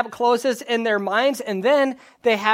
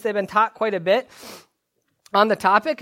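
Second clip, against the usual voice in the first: normal talking voice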